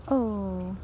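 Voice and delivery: ordinary talk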